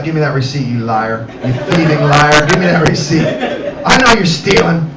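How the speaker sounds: low, gruff mock interrogation; mock accusing growl. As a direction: grumble like a suspicious door checker. Costco checker accusing shopper.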